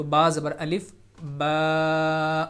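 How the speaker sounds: با زبر الف با drawn out slowly, long aa; long drawn baa; stretched baa. Shown as a demonstration. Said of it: "اس کو اگر ہم کھینچ کے پڑھیں گے".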